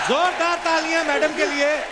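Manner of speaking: calling out. Real, not acted. Host calls for applause.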